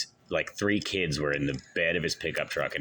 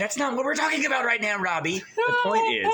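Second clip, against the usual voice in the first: exasperated. Real, not acted. Exasperated at Robbie.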